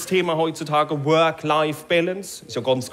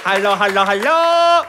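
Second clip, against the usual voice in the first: louder, rising hello into the empty shop; calling out; 6 dB louder than usual. Retold or imitated. Customer calls for staff in the empty shop, louder.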